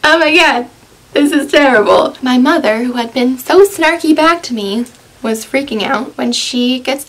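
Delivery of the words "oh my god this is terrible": panicked. Retold or imitated. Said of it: "I was panicking".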